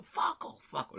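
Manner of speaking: high mock yell, easing off; imitating a passionate drunk's shout; retold heckle. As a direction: yell it like a drunk fan on his feet. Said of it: "he's out of his seat now".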